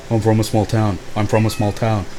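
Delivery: low, flat, monotone repeating; droning repetition. Retold or imitated. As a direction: drone it out dully. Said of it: "he just kept repeating".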